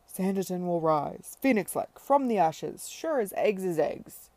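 loud, grand declaration with arms flung wide; booming proclamation. Acted. Tom vowing Sanditon will rise again, booming. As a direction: proclaim it to the whole street.

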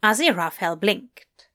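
ordinary delivery, nothing marked

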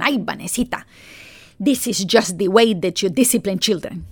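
imitating a mother's Colombian accent; lilting Colombian-accented English, affectionately imitated; adorable Colombian accent. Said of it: "in her adorable Colombian accent".

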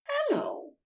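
high, refined greeting; a bright upper-class hello; posh voice. A narrator's reading. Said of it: "a posh voice said".

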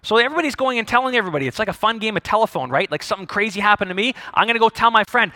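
usual speaking voice

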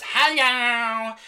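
put-on shrieking for attention like a toddler; demanding toddler hello. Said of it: "Jasmine bounces up again and goes".

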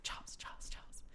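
imitated whisper, very soft; hushed mock self-reminder; whispered rehearsal. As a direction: whisper the name over and over to memorize it. Retold muttered rehearsal before meeting.

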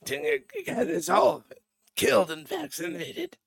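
put-on high, strained, barely-alive old man voice; RFK Junior impression. Podcaster mocking RFK Junior, doing a strained old man voice. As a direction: croak it out like a frail old man. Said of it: "I'm gonna start sounding like him here".

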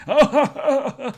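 loud, high mock laughing; imitated guffaw. Salesman asked for a discount, loud imitated laughing. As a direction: laugh off the request, big and high.

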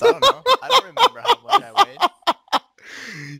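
high, loud laughing words. Real, not acted. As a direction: talk through big laughter. Laughing while answering.

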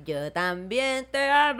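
tender reply. Pretend. Answering fans who say te amo.

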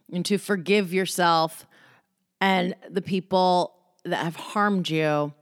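ordinary talk, nothing marked

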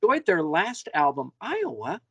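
put-on high old-lady voice breaking into laughter; sweet granny voice. Host mimicking a granny praising Iowa, laughing high. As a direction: play the granny, then squeal in disbelief. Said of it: "But they're like".

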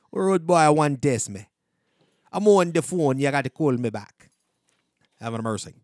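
put-on Jamaican patois; Jamaican accent. Host showing off accents on request, mock Jamaican accent. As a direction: do a punchy, hammed-up Jamaican accent.

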